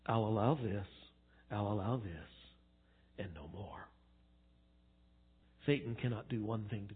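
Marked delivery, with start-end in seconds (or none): 0.0-3.9 low, soft, measured decree; calm, hushed authority; God setting limits. Retold or imitated. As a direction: grant it quietly, then close it off lower still. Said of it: "God says".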